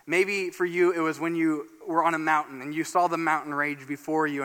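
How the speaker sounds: ordinary delivery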